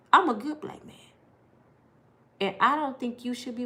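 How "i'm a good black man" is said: firmer claim. Shown as a demonstration. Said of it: "louder repeat".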